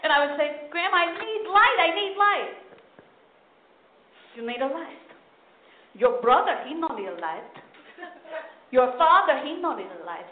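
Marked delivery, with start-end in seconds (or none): 0.7-2.5 put-on pleading child voice, pitched up; a kid begging for light; whiny kid. 4.3-10.2 mock grandma scolding.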